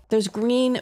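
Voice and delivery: normal talking voice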